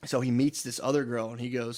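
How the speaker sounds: ordinary delivery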